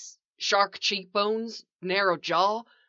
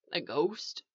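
soft, faint question; weak voice; 7 dB quieter than usual. A narrator's reading. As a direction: ask it weakly, barely projecting. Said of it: "voice weak".

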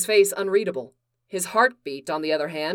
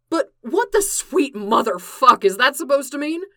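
loud, higher, sharp retort; sharp reply; 6 semitones higher, 6 dB louder than usual. Acted. Clark throwing Bruce's jab back, loud and sharp. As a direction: fire it back hard and loud. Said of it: "Clark replied sharply".

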